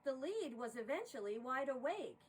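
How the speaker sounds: lively rhyming poem read aloud; poem recital. A narrator's reading. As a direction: narrate the verses with bounce and rhythm. Reads a holiday poem aloud.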